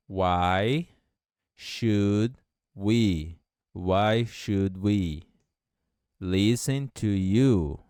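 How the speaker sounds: slow, clear teacher model of "Why should we listen to you"; slow model phrase. Shown as a demonstration. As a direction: say it slowly so students can copy. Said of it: "Repita a frase". (frase modelo, devagar e clara)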